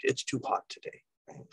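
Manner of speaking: whispered, leaning on too; emphatic whisper. Shown as a demonstration. Teacher whispering the point to the class. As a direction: hush it to drive the point home. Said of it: "I'm really making a point".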